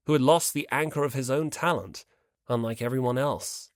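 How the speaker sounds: ordinary delivery, nothing marked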